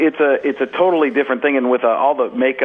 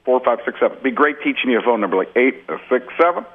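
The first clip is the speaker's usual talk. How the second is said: halting presidential cadence, then running on; Barack Obama. Shown as a demonstration. Comic counting out numbers to show Barack Obama's cadence. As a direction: count with pauses, then rattle off the rest. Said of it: "It's always two parts".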